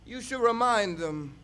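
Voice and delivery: intense spoken word performance, rising and falling; slam poetry. Acted. Poet performing on stage for the audience, impassioned spoken word. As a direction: preach the poem to the crowd like a sermon.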